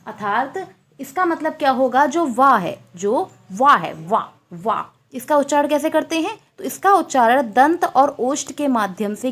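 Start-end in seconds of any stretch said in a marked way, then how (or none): 4.0-4.8 वा said with lower lip on teeth, softly; वा sounded out low and soft; demonstrating the sound. Shown as a demonstration. Teacher demonstrating वा to the class. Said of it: "बा को आप उच्चारित करके देखें".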